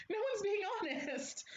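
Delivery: breaking into high laughter mid-phrase; laughing. Real, not acted. Laughing at pageants to her viewers, laughing. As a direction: laugh through the words.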